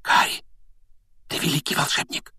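voiceless whisper into an ear. A narrator's reading. Hermione whispers in Harry's ear, hushed. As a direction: whisper it as an intimate tribute. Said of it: "прошептала Гермиона ему на ухо".

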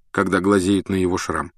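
ordinary delivery, nothing marked

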